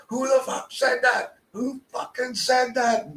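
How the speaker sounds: high, slow, menacing mock demand; crazy man on bus. Imitating a crazed bus passenger demanding who spoke, high and slow. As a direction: drag it out like an unhinged stranger. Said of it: "He's like".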